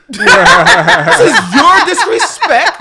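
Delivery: loud, high, laughing accusation; hammed-up high-pitched scolding; mock accusation. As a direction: hurl comic blame through laughter. Acting the accusation while laughing.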